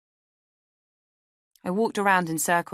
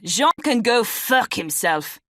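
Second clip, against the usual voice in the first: put-on loud, higher, slow French-accented contempt; spat out angrily; 6 semitones higher, 7 dB louder, slower than usual. A narrator's reading. French flatmate cursing his roommate, spitting angry. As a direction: spit it out in a furious French accent. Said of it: "he spat".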